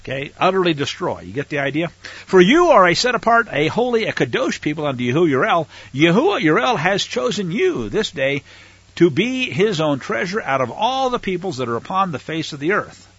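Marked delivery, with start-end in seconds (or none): 2.2-13.1 loud, raised scripture reading; fervent sermon reading; preaching. Acted. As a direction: proclaim it to the congregation.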